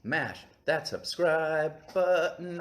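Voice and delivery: slow, hammed-up YouTuber plea. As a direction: do the big YouTuber pitch. Mock YouTuber call to action.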